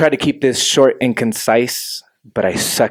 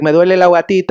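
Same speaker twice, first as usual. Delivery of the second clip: higher, louder Chilean Spanish line; mock complaint in Spanish; 7 semitones higher, 4 dB louder, much faster than usual. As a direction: say it like a kid with a tummy ache. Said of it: "I'm gonna be like".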